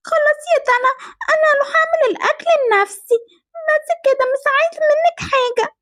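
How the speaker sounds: put-on high, whimpering little-child voice; tearful sulk. Little sister sent away unfed, tearfully sulking.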